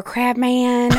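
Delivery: a Georgia sweet-talker's pet name; lightly sugared Georgia accent; sweet Georgia drawl. Retold or imitated. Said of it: "She was talking like she was from Georgia".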